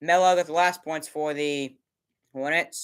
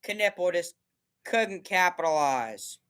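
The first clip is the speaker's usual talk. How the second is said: usual speaking voice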